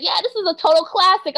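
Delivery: high-pitched student imitation; little girl voice. Little girl praises the old song, high imitated voice. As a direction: chirp it high like a kid. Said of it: "this one little girl said".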